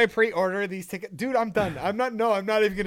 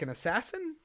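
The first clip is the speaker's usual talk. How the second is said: ordinary talk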